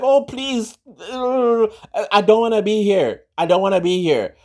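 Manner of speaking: high, pleading mock whine; imitating a reluctant voter whining; whiny reluctant moan. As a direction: moan it like you're dragged there against your will. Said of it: "and you're like".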